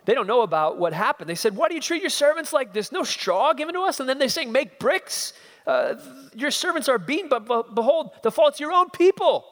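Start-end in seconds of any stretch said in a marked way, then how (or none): 1.6-9.4 much higher, louder desperate cries; anguished protest. Acted. Foremen crying out to Pharaoh, high desperate protest. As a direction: cry out in protest to the king. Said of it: "they cry to Pharaoh".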